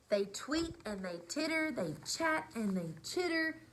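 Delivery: lively storybook read-aloud; reading to children. A narrator's reading. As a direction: read it like bedtime story time. Reads picture book aloud.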